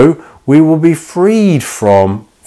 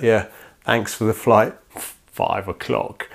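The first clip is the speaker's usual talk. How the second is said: muttered disbelief; 8 dB quieter than usual. Retold or imitated. Passenger privately doubting the pilot, muttered disbelief. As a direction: mutter it under your breath, slowing on the time.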